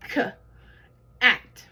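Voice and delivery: k and at split apart, at said loud; teacher sounding out the onset and rime. Shown as a demonstration. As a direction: separate the onset k from at. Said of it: "Now let's blend it together".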